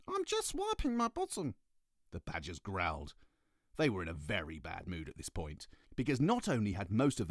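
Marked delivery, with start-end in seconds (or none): 0.1-1.6 very high squeak from upstairs; high squeaky voice. Acted. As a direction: squeak it like a tiny badger. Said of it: "came a high, squeaky voice".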